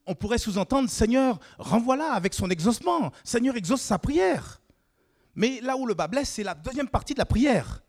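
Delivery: rising sermon fervour; fervent preaching. Acted. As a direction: preach it out to the whole church. Preaching at full force.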